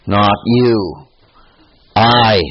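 "you" stressed, then a long, loud "I"; contrastive stress on I. Acted. God setting himself apart from all others, emphatic I. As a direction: pause after "you", then land hard on "I". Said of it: "in contradistinction to all others".

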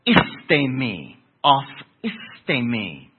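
"istemi" said slowly as ees-TAY-mee, then "off istemi" with the Greek vowels; Greek pronunciation. Shown as a demonstration. Preacher teaching the congregation the Greek word, slow careful Greek. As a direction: spell out "off istemi" syllable by syllable in Greek. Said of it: "is the word aphistemi".

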